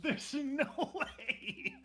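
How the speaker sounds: high, drawn-out laughing protest; real incredulous laugh, slow and high; laughing disbelief. Real, not acted. Laughing disbelief at friend's claim.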